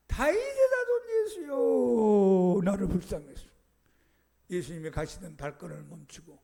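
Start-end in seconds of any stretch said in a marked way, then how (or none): 0.1-3.5 long, high, drawn-out cry; crying out with all his strength. Acted. Blind beggar shouting to Jesus, loud drawn-out plea. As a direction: stretch 자손 into a loud pleading wail. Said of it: "목이 쉬도록, 젖먹던 힘을 다해서".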